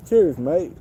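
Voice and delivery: playful Aussie "cheers, me mate"; Australian accent. Pretend. To the bird, a light mock Australian greeting. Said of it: "That's Australian, huh?".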